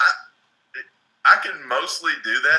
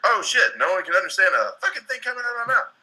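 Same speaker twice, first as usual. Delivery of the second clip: higher-pitched retold dawning alarm; mock realization; 7 semitones higher than usual. Recalling his college self learning a lesson, mock alarm. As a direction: act out suddenly realizing the problem.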